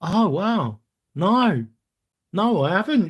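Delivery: surprised. Real, not acted. Reacting to chat message.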